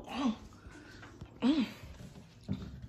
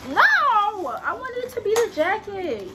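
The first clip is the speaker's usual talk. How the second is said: higher-pitched wail of disappointment; disappointed whine; 9 semitones higher, 4 dB louder than usual. Real, not acted. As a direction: whine it, let down by the parcel. Let down on opening package.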